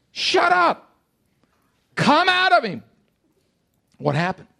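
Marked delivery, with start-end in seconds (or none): 0.1-1.0 high-pitched, forceful rebuke; stern command. Acted. 2.0-2.8 high, forceful casting-out command; commanding a demon. Acted. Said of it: "he's speaking to the demon".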